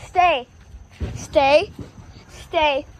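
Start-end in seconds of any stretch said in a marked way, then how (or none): none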